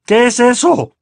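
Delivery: high, loud growled demand; tense, indignant growl; angry growl. A narrator's reading. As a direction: demand it like an irate uncle. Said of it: "gruñó tío Vernon".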